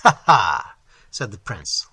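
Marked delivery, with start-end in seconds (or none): 0.0-0.8 loud, mocking ha ha; scornful laugh. A narrator's reading. Prince scoffing at the stranger's warning, scornful laugh. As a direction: laugh him off with contempt. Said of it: "laughing scornfully".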